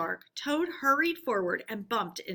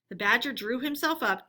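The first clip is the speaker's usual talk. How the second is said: ordinary delivery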